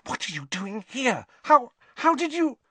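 high, breathless astonishment; startled girl. A narrator's reading. Hermione stunned Harry appeared, high and startled. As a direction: voice jumps up in surprise.

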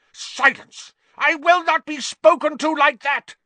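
shrieking high and loud; pompous shrill tyrant. Acted. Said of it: "Snape shrieked".

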